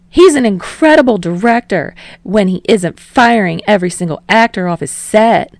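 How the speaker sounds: mock him with a quick sneer. A narrator's reading. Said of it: "Yamada sneers".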